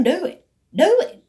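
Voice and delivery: goading. Retold or imitated. Daring her to pick up the phone, insistent.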